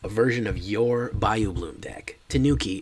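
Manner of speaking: normal talking voice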